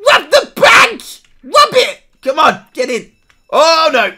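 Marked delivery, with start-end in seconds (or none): 0.1-2.0 high, loud rallying yell; excited shout. Real, not acted. Urging teammates to rob the bank, loud excited yell. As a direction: whip the crew into the heist.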